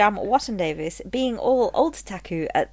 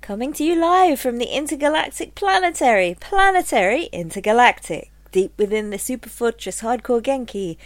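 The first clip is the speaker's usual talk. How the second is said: announcer; 2 semitones higher, 5 dB louder than usual. Acted. Announcing the show intro.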